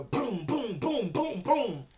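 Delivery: loud, high repeated booms; imitated crashing booms. Game master voices a body crashing through pews, booming thuds. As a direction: punch out each crash as a separate boom. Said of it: "you can hear his body as it goes through the pews".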